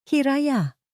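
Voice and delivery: high, pleading call of a name. A narrator's reading. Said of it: "Nagsusumamong tawag ni Rico".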